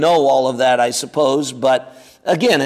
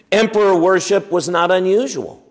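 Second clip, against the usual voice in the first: ordinary delivery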